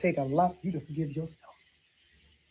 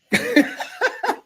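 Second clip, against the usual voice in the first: high laugh; 5 dB louder than usual. Acted. Laughing about mamas in heaven.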